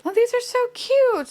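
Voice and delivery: high-pitched cooing at a cute toy; delighted squeal. Real, not acted. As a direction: gush over something adorable. First sight of the toy.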